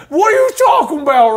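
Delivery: loud, high mock southern accent; super southern. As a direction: exclaim in an exaggerated southern accent. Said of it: "I don't know why I go super southern".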